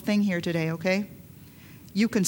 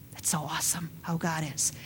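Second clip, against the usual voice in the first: whispered, much softer; preacher awed and hushed before the church; hushed awe; 7 semitones lower, 10 dB quieter than usual. Real, not acted. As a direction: let it fall to a hush in wonder. Awed hush about God.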